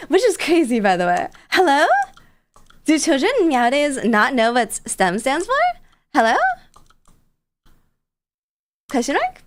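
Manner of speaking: loud, incredulous "hello?"; mock outrage. Real, not acted. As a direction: act scandalized at the question.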